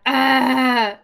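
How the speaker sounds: loud, drawn-out cry of dismay. Real, not acted. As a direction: wail it loudly, stretching the words. Dismay at finding dust bunny.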